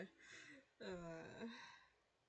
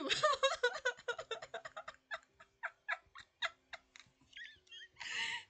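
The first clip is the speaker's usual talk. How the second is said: loud cackle; 12 dB louder than usual. Real, not acted. Losing it at game scene.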